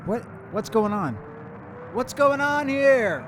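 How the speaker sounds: high, loud, slow panicked cry; rising alarm, losing it; panicked yell. Acted. Brian panics at the mysterious music, loud and scared.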